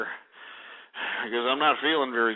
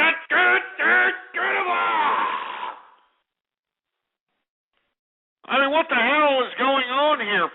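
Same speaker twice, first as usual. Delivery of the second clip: exasperated yell; 13 semitones higher than usual. Real, not acted. Host shouting at a prank caller in exasperation.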